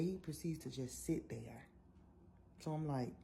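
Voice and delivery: ordinary talk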